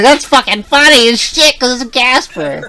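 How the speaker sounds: high, loud and drawn-out retold excitement; amused outburst. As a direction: crow it like a delighted kid. Mock-quotes own delighted past reaction.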